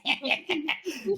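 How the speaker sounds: high cackling laugh; maniacal laughter. Acted. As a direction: cackle like a mad villain. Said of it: "Pagette's maniacal laughter".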